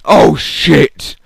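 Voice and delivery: loud, high mock shock; startled audience reaction. Audience reacts to the comedian's yell, loud startled exclamation. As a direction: imitate a crowd jolted by a sudden yell. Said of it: "everyone in the audience went".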